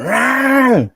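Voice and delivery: high, loud roar; mock roar. Motorcyclist roaring at a pedestrian on her phone, loud. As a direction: blast it at a pedestrian who stepped out. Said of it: "kicked out a gear and went".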